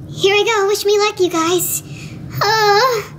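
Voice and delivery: normal talking voice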